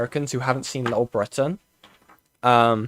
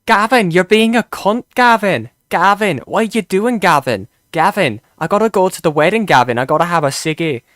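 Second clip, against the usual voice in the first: put-on higher, louder whiny Welsh accent nagging Gavin; mock Welsh sitcom voice; 5 semitones higher, 6 dB louder than usual. Streamer parodying Gavin and Stacey, nagging in a mock Welsh accent. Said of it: "Gavin and Stacey just like".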